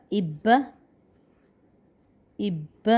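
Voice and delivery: "اب" as ibba, the b held long before release; slow geminated ib-ba for "اب"; pressed doubled consonant. Shown as a demonstration. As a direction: say "اب" with an i, then press hard into a double b. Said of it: "اسی طرح ہی".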